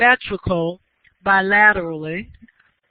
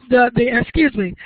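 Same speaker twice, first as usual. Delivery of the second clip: ordinary delivery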